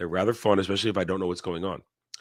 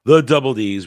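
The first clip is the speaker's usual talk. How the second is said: big, booming host welcome; show-opening greeting; 3 semitones higher, 11 dB louder than usual. Acted. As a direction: hail the audience loudly as the show opens. Opens the live show.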